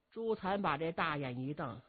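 ordinary delivery

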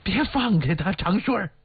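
put-on urgent, high-pitched order from an old woman; anxious urging. A narrator's reading. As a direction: call out anxiously to hold on. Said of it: "马老太太着急地说".

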